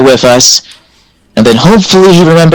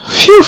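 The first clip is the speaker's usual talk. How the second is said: relieved phew. Real, not acted. Relief after rolling twenty.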